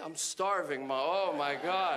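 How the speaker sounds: low, drawn-out stoner groan; stoned teen. Acted. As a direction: drag it out like a hungry stoned kid. Plays his stoned younger self to mom.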